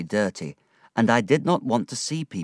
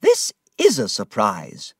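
surprised cry; 4 dB louder than usual. A narrator's reading. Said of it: "she cried".

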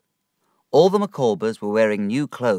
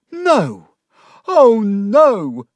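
shouted; 13 semitones higher, 9 dB louder, much slower than usual. A narrator's reading. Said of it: "Mister Micawber shouted".

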